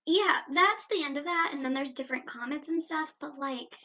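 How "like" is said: normal talking voice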